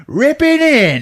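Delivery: high, loud gleeful yell; hammed-up maniac cry; Old Greg. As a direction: crow it like a crazed attacker. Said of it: "And he's just laughing".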